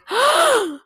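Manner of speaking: high loud gasp of shock; sharp shocked gasp. Retold or imitated. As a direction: gasp in horror. Shocked at the news.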